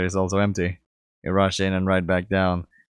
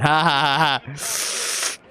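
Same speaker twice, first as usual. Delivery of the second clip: slow forced laugh; 6 semitones higher, much slower than usual. Acted. Relieved bragging laugh.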